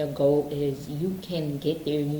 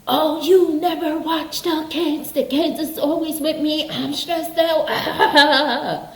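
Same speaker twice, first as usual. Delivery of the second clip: much higher, whining mock complaint; whiny victim voice; 11 semitones higher, 6 dB louder, slower than usual. Mocking a parent who whines to spouse, high whiny voice. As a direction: moan it like a put-upon victim, half laughing.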